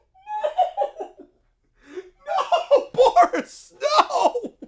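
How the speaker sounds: very high, loud laughing cries of no; laughing screams. Real, not acted. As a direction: shriek no while cracking up. Losing it at Boris twist.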